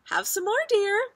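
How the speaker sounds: high, loud sing-out offer; doting mother. A narrator's reading. Mother offers toast in her sleep, high doting voice. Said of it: "Missus Watson said".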